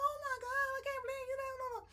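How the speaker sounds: high-pitched mock wail, rushing at the end; hysterical. Woman wailing at the man who shot her date, hysterical. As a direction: cry out in imitated hysteria. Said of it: "So she's all hysterical".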